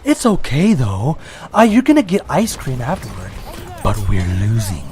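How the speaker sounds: low, calm mock reassurance; calm announcer lady. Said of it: "she says it so calmly, like".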